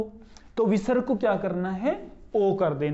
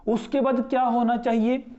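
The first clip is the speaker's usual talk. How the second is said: ordinary delivery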